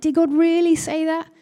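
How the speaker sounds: high, incredulous serpent's question; voice of doubt. Retold or imitated. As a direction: imitate the tempter casting doubt. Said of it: "it's the voice of doubt".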